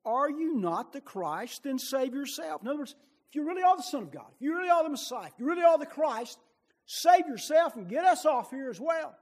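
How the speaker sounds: loud, high, jeering taunt from the cross; the criminal shouting mockery at Jesus, loud and high; railing criminal. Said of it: "railed at Him".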